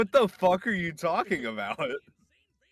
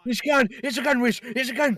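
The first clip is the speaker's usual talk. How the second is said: high, loud excited yelling; excited shouting; 10 semitones higher, 7 dB louder, faster than usual. Real, not acted. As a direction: shout it in disbelief. Shocked reaction to trailer.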